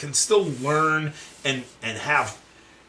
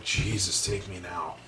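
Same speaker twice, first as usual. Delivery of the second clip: low, much softer defeated groan; weary despair; 7 semitones lower, 8 dB quieter than usual. Retold or imitated. Waiting behind a man writing a check, low defeated mutter. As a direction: sink down like you've given up.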